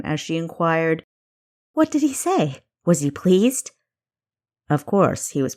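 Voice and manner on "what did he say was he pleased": high, bursting with eager questions; barely contain the urge to bounce; young spy eager for her boss's praise; eager excitement. Acted. Kessa eager for Reese's verdict.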